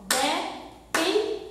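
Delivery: "Depende" broken into three beats, DE-PEN-DE; syllable by syllable. Shown as a demonstration. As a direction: count the syllables of "Depende" aloud. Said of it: "ó".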